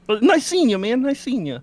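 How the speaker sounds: Kermit voice. Acted.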